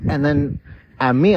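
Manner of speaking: ordinary talk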